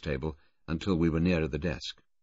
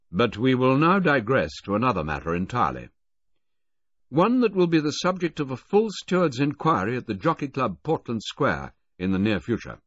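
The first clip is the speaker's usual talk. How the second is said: raised, louder officer's voice; Brigadier; 4 semitones higher, 6 dB louder than usual. A narrator's reading. Brigadier formally confronting Filmer, loud and stern. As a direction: announce it like a presiding military man.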